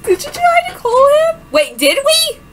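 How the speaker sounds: high and loud eager asking; excited high question. Acted. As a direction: press her eagerly.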